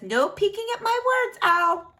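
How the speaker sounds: high, quick scold; Frank scolding. A narrator's reading. Frank telling the owl not to peek, high and quick. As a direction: tell the owl off. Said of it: "he says".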